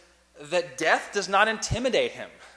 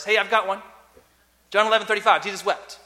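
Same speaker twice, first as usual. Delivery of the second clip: put-on louder, higher, eager child reciting; imitating a proud kid blurting his one verse; a boy in Bible class showing off his memory verse, excited; 3 semitones higher, 7 dB louder, faster than usual. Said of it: "I was always excited if there was a".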